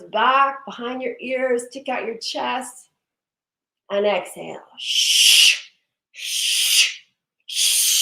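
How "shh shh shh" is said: three sharp hissing shh breaths out; forceful shh. Shown as a demonstration. Teacher showing the class the warrior breath, loud shh. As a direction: push the air out hard on each shh. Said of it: "Watch me".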